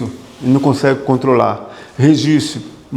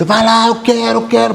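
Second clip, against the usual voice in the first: higher, pushy voice of craving; mind shouting; 7 semitones higher than usual. Retold or imitated. Preacher acting the craving mind urging him on, higher and pushy. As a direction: voice the greedy mind egging you on. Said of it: "A mente, ela grita".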